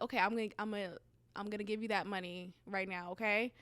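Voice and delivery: quiet and firm, settle her; calm. Retold or imitated. Cousin promising money, soft and steady. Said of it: "she's like".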